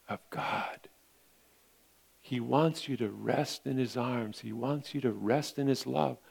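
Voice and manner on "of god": voiceless, hushed "of God"; breathing the name of God tenderly; whispered. Shown as a demonstration. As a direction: let it fall to a whisper. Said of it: "ends in whisper".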